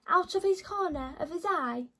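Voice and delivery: ordinary delivery, nothing marked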